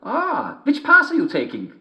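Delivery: high sly wolf voice; big bad wolf sweet-talking a girl. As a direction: greet her like a cunning wolf. Wolf asks path.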